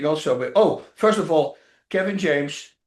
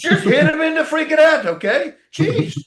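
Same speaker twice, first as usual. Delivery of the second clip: much higher and louder, laughing through it; fed-up laughing yell; 11 semitones higher, 6 dB louder than usual. Retold or imitated. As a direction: shout the order, fed up and amused. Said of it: "I get fed up".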